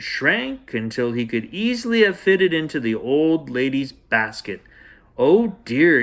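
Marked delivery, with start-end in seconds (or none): 5.2-6.0 a little louder, falling oh dear; dismayed gasp. A narrator's reading. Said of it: "he gasped".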